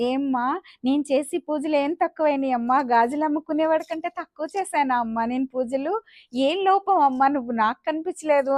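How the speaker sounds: ordinary delivery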